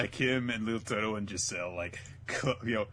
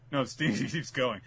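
ordinary talk, nothing marked

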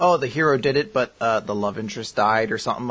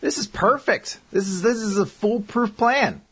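gleeful scheming; 8 semitones higher, slower than usual. Acted. Gloating over evil plan.

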